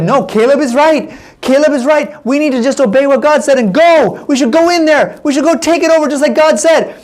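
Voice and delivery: high-pitched, insistent acted plea; urgent rebuttal. Joshua backing Caleb before the people, urgent rebuttal.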